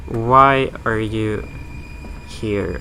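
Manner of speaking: usual speaking voice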